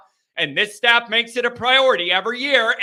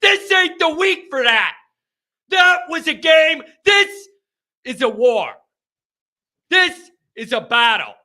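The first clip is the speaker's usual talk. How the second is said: high, loud, emphatic declarations; rally them for battle, voice raised; fired-up rant; 9 semitones higher, 5 dB louder than usual. Real, not acted. Host declaring this game a war, fired up.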